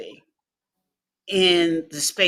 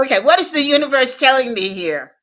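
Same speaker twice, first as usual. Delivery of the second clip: higher, louder, fast rush of wonder; excited realization; 6 semitones higher, 6 dB louder, faster than usual. Retold or imitated. Asking the universe after the mentor's praise, excited. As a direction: blurt it out, amazed.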